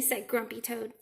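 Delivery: usual speaking voice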